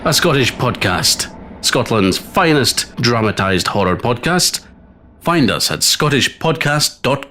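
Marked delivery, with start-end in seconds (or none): none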